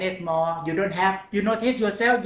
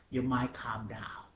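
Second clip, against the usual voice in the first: soft calming whisper; 4 semitones lower, 10 dB quieter than usual. Acted. Teacher calming students into relaxation, whispered. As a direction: whisper it gently, softer.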